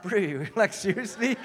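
incredulous, laughing through the words; laughing disbelief. Retold or imitated. Surfer laughing at the man who just pushed him, amused disbelief. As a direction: break up laughing at an empty threat, voice rising. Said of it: "I just started laughing".

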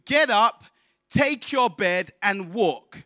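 ordinary talk

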